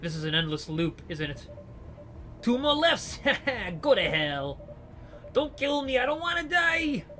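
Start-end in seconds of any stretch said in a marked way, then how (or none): 2.4-3.8 high, gleeful counting shout; a gangster counting the survivors. Acted. 3.8-7.1 high, desperate cry; a prisoner pleading as shots continue; terrified plea. Acted.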